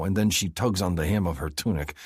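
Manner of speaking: ordinary delivery, nothing marked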